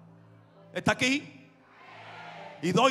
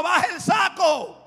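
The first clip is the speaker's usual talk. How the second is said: driver's order; 7 semitones higher, 5 dB louder, slower than usual. Retold or imitated.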